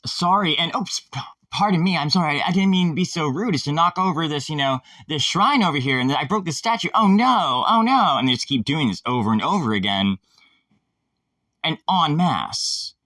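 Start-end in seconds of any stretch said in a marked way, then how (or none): 0.0-8.2 higher, hammed-up innocent apology; fake apology. Fake apologies for breaking statues.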